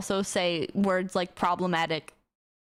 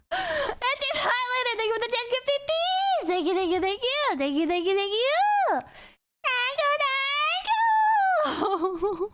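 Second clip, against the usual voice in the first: squealed thanks. Real, not acted. Streamer thanking a viewer for gifts, high excited squeal. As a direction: gush thanks in a tiny high-pitched voice.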